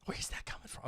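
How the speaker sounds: whispered aside, wondering; hushed, curious question; puzzled whisper. Real, not acted. Puzzled aside about caller's accent.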